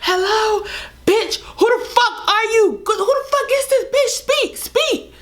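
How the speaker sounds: shrill, high mock scream of a furious girl. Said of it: "she's screaming at the top of her lung".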